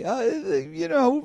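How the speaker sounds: high, soft, slow hedging; tentative parent easing into an objection; hesitant parent. Acted. Hesitant parent voiced to child.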